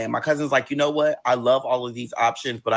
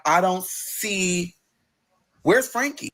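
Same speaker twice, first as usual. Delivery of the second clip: confused searching; 6 semitones higher, much slower than usual. Retold or imitated. Searching the wall for mother.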